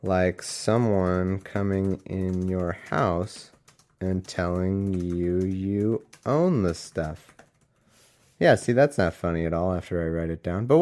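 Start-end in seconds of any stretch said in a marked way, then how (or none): 0.0-7.3 slow, softer, word-by-word delivery; dictating while typing. Real, not acted. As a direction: draw out each word as you type it. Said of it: "dictate the notes that I'm typing".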